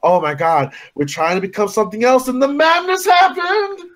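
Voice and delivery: rising to a high, drawn-out mock cry; mock movie hysteria. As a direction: build from exclamation to hammed-up panic. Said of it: "we're just doing that whole".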